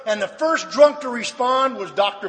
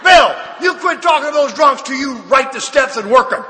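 mock scolding; 4 semitones higher than usual. Members imagine telling Bill off today, mock scolding.

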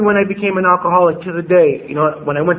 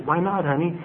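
gentle mother; 8 dB quieter than usual. Retold or imitated. Mother voiced comforting child.

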